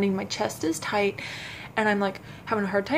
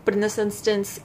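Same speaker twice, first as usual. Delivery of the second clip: ordinary delivery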